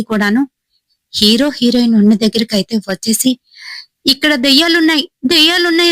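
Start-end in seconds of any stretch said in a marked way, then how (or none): 4.1-5.9 panicked cry. A narrator's reading. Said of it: "అని భయపడుతూ".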